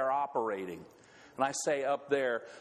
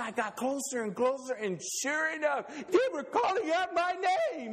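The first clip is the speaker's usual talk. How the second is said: Ole's voice; 11 semitones higher than usual. Acted. Ole telling Lena they called his name, building up. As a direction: build up the reveal. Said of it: "he said".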